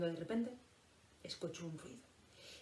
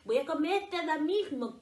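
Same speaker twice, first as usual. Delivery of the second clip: wolf's threat; 7 semitones higher, 6 dB louder than usual. Acted. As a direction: roar it like a hungry wolf. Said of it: "gritó el lobo".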